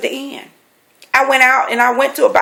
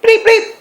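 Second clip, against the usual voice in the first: high mock key-fob bleeps; imitated car bleep; 13 semitones higher than usual. Narrator mimicking her car fob, high bleeps. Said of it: "I'm like".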